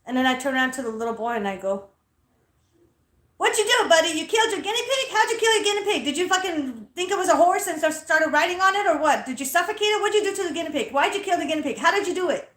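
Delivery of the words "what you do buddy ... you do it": high, rapid-fire mock grilling; loud interrogation. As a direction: pepper the kid with loud accusing questions. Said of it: "So I'm loud".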